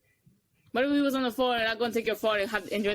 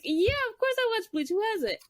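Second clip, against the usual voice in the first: high, incredulous retold reply; exasperated; 11 semitones higher than usual. Answering a customer asking about Bleach, exasperated. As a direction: pitch way up, as if it's obvious.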